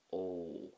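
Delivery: "O" held out as a long "oh"; longer O. Shown as a demonstration. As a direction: linger on the "O". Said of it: "say it a little bit longer".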